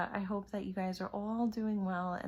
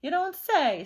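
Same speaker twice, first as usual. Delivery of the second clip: loud, high mock surprise; a loud, high, sarcastic reply; 7 semitones higher, 9 dB louder than usual. A narrator's reading. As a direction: play innocent, dripping sarcasm. Swamp feigns surprise to principal.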